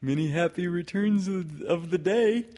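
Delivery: sung-out storybook greeting; calling out. A narrator's reading. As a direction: call it out high and clear. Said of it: "called out Pooh".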